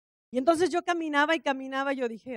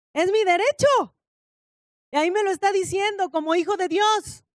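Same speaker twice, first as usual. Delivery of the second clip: loud, higher, fervent preaching; 4 semitones higher, 6 dB louder than usual. Real, not acted. Preacher to congregation, proclaiming loud and high. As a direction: proclaim it to the whole church.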